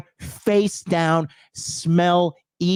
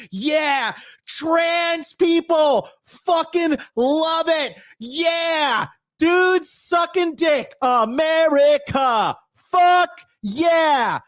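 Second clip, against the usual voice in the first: high, slow, exaggerated cheering voice ending in a shout; sarcastic high cheer; mock cheer; 12 semitones higher, much slower than usual. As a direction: ham up a falsetto cheerleader. Mocking cheering voice.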